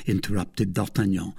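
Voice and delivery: usual speaking voice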